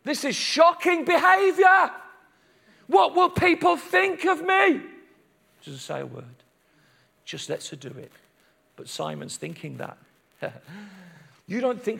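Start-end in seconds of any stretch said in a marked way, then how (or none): 0.0-4.8 mock outrage, rising higher to the end; pompous, scandalised objection; offended respectable rabbi. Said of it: "Jesus doesn't turn to her and say". 5.6-10.0 low, soft, matter-of-fact; quiet, confiding narration; hushed contrast. A narrator's reading. Drops down after the mock outburst.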